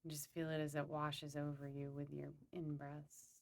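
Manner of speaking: calm, unhurried meditation guide; guided meditation. Acted. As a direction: lead them gently through a visualization, pausing between phrases.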